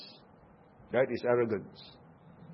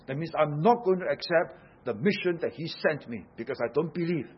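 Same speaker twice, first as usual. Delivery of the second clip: ordinary talk, nothing marked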